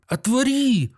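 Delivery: a high, loud cry; shout. A narrator's reading. Doctor pounds at the door, shouting to be let out. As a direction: demand it, shaking all over. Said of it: "крикнул Андрей Ефимович, дрожа всем телом".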